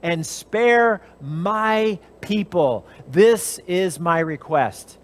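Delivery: slow, deliberate plea; slow pleading queen. Acted. Esther pleads before the king, slow and earnest. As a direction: drag the plea out word by word. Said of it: "She says".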